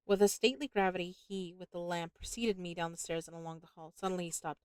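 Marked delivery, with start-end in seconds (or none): none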